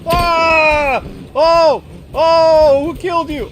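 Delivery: high, loud frantic cries; panicked yelling. Real, not acted. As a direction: shriek in fear and confusion. Losing the tiger, panic.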